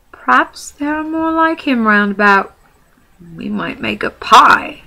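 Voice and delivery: high, loud, slow troll musing; scheming troll. Acted. Trolls plan a pie.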